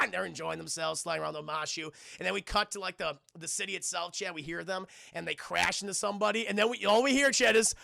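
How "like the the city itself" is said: ordinary talk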